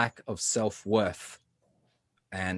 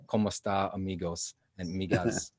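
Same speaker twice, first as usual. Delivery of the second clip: Spanish greeting; 3 semitones lower, 6 dB quieter than usual. Shown as a demonstration. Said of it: "That is like saying".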